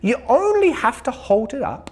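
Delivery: high bright sheep; chirpy sheep invites bear; cheerful sheep voice. A narrator's reading. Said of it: "said Sheep".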